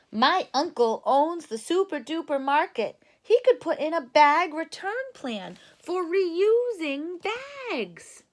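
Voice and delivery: proud Too Tall; a touch of a swaggering big kid. Acted. Too Tall's idea.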